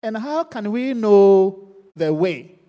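loud, slow plea to the Lord; Thomas pleading. Acted. Preacher voicing Thomas begging the Lord, loud and slow. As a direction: cry out slowly like a lost disciple. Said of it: "Thomas said to him".

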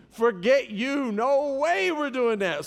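slow, high, emphatic refusal; mock-defiant brush-off; retold refusal. Acted flat refusal to his wife.

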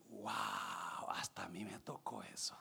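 hushed, low, near-whispered wow; moved awe. Retold or imitated. Pilot tells the instructor he was moved, hushed low awe. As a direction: imitate a man quietly touched.

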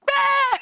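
high shrieking "Ah!" from the back seat; mock child scream. Father imitating his kid in the car, sudden high shriek. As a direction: squeal like a startled kid.

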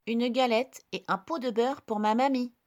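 put-on high, bright little girl. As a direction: play the small girl. Girl answers wolf.

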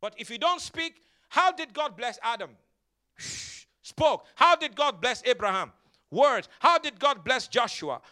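loud call-and-answer sermon climax; full-force preaching. Real, not acted. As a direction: build each question, belt out the answer. Rapid question-and-answer preaching climax.

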